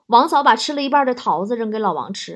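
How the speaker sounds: ordinary talk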